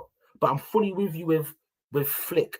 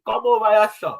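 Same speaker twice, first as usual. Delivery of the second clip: mock calling out; 6 semitones higher, 8 dB louder than usual. Calling a manager over to Arsenal.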